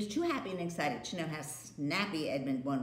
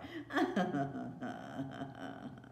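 wicked laugh; 5 semitones lower than usual. Acted. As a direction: cackle under the breath. Villain's laugh.

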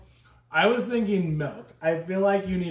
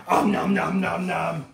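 playful nom nom eating. Pretend. Pretend eating noises.

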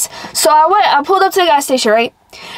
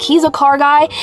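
ordinary delivery

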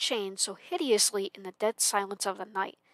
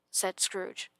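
ordinary delivery, nothing marked

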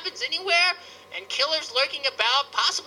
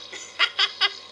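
short amused chuckle; quick burst of giggles; laugh; 6 semitones higher than usual. Real, not acted. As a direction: chuckle briefly at what you see. Laughs at the game.

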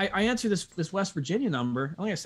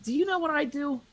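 mock indignant. [name] challenging the rep.

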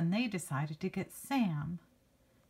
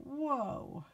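amazed whoa; 8 semitones higher, 3 dB quieter than usual. Shown as a demonstration. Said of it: "old-fashioned way to go".

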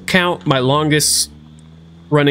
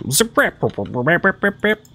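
higher playful zip-rip noise, each rip rising; speed-up time noise; 6 semitones higher than usual. Pretend. Gamer fast-forwarding time again, higher playful mouth noise. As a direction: rattle off rips like a fast-forward whir. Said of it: "That's my speed up time noise".

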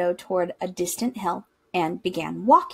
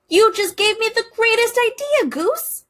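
high, bright burst of a sudden idea; much higher, eager duck voice; excited quack; 12 semitones higher, 5 dB louder than usual. A narrator's reading. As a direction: squeal it out like a thrilled little duck. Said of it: "he quacked".

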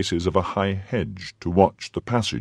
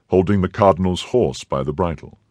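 ordinary delivery

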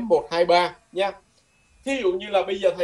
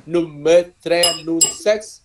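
slow model sentence; much slower than usual. Shown as a demonstration. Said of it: "phòng số ba trăm lẻ sáu".

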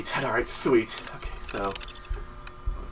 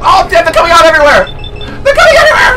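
high, panicked outburst; alarmed yelling; 8 semitones higher, faster than usual. Real, not acted. Player sees enemies coming out everywhere, high alarmed yell. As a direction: yell out in rising alarm, voice leaping up.

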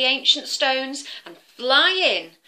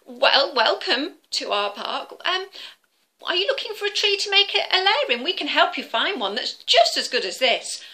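higher, stammering, hurried squirrel voice; nervous squirrel; 7 semitones higher than usual. Acted. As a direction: stumble over the words, scared. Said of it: "He was a bit nervous".

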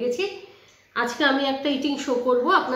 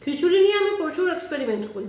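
speaking between bites; chewing while talking; 4 semitones higher, faster than usual. Real, not acted. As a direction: mouth partly full of food. Seen 2: Ate at -3s; cheeks and mouth suggest chewing while she speaks.

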